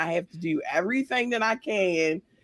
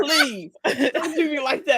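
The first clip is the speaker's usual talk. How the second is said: laughing plea; 5 semitones higher than usual. Real, not acted.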